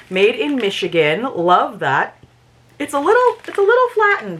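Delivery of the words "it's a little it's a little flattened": much higher, cartoonish squeak; squeaky voice over the flattened bag. Real, not acted. Squeaky repeat about squashed popcorn.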